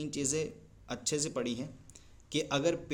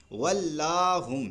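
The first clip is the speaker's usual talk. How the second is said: ولاہم chanted slowly and drawn out; measured Quranic reading; slow recitation; 3 semitones higher, 6 dB louder, much slower than usual. Shown as a demonstration. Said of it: "ولاہم میں آپ".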